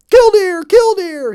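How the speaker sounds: high, loud piping bird cry; piped bird call. Acted. Plover piping an alarm over the possum, high and loud. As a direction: pipe it out as loud as a little plover can. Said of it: "he piped as loudly as he could".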